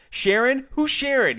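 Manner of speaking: high, loud flustered stammer. Acted. George panicking about the manager, flustered loud stammer. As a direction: blurt it out in a panic. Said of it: "he stammered".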